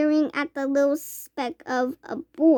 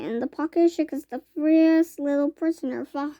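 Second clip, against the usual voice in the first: ordinary delivery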